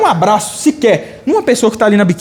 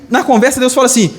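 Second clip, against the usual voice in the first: ordinary delivery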